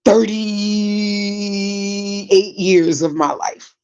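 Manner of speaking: THIRTY-EIGHT with a very long held "thirty"; drawn-out emphasis. Real, not acted. Telling how long she lived in Brooklyn, THIRTY stretched out.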